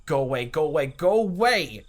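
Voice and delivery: loud, high furious shout. Acted. Keiichi shouting at Rena, loud. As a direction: bark her away.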